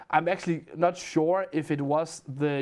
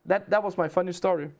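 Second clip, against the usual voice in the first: ordinary delivery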